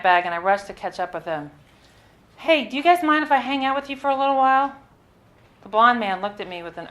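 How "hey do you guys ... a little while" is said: high, louder and fast; eager hurried ask. Retold or imitated. Traveller catching up with a couple, eager and rushed. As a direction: call out while hurrying after them.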